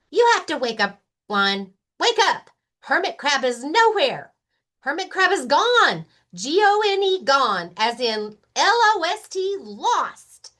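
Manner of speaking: high, loud, anxious bunny voice; urgent Rabbit. Acted. Rabbit begging sleepy Lion to wake, high and urgent.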